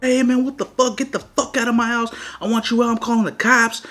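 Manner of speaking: high-pitched, worked-up protest; imitated angry dad. Dad ordering the gunman off his property, imitated high-pitched anger. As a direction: act out Dad running up hollering.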